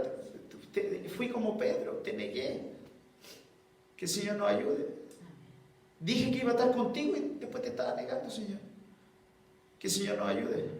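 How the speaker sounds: soft, choked pleading; tearful confession. Retold or imitated. Pastor confessing his betrayal to the Lord, tearful. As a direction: confess quietly through tears.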